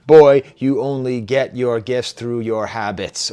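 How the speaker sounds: put-on slow, measured rap line, loud on "Boy"; imitating a deliberately paced rap delivery; broken up with pace. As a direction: space out each word rhythmically. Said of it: "break that up with some pace".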